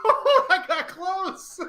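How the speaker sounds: laughing. Real, not acted. Near miss at finish.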